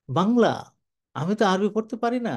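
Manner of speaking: a hint of an elderly man admitting he can't read Arabic; old man's reply. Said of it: "বুড়ো মানুষ".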